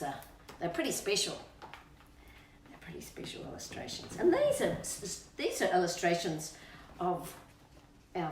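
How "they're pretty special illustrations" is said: soft unvoiced whisper; hushed whisper of admiration. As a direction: breathe it like a secret. Hushed aside about the book.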